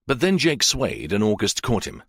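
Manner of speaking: ordinary delivery, nothing marked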